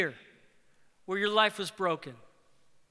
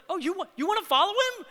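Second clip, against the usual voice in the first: much higher, buoyant and effervescent; Buddy the Elf; 12 semitones higher, 5 dB louder, faster than usual. Acted. Healed man teasing the Pharisees, effervescent high mock delight. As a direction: gush it like a wide-eyed elf. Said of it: "literally Buddy the Elf, like, if he is really that buoyant and effervescent".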